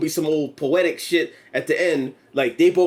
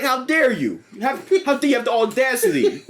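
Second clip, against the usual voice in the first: mock indignant villain; 6 semitones higher than usual. [name] indignant at exposure.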